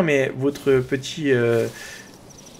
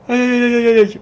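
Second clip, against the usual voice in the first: high, louder hammed-up aïe aïe; mock dismay; 9 semitones higher, 7 dB louder than usual. Real, not acted. Youtuber signs off to viewers with a hammed-up high cry of dismay. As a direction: play up fake dismay to close the video. Joking end of video.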